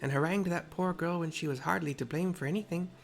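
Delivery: put-on Tepeke's mixed accent. Tepeke scolds the wandering girl in a mixed accent. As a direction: scold the child gently in a put-on foreign accent. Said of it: "the accent I've chosen".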